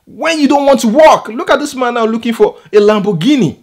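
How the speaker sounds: very high, loud, exasperated outburst; outraged scolding. Real, not acted. Vlogger berates the man looking for a Lamborghini, outraged. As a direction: berate him in real exasperation.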